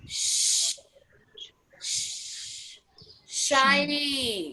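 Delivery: शाई with a long hushed sh before it; drawn-out sh. Shown as a demonstration. Teacher drawing out the sh sound of शाई for the class. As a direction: hiss the sh, then land on शाई. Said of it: "next".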